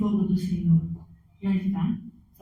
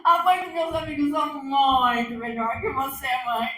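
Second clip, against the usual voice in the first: mocking kid; 5 semitones higher, 5 dB louder than usual. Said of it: "o filho fala".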